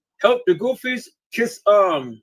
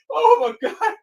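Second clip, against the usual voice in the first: laughing hard; 13 semitones higher, slower than usual. Real, not acted. Helpless after long laughter.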